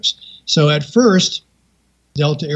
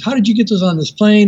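raised-pitch retold scolding; nasty; 6 semitones higher than usual. Attendant confronting woman about the gliders, nasty. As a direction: imitate an indignant flight attendant demanding answers. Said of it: "the flight attendant got really nasty".

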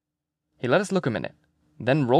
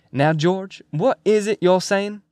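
higher, drawn-out coaxing question; lightly voiced McMurphy, coaxing; 7 semitones higher, 4 dB louder, slower than usual. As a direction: coax a mumbler to speak up.

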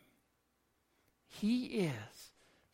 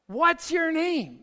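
Moses asking; 9 semitones higher, 8 dB louder than usual. Retold or imitated. Moses questions God in Exodus.

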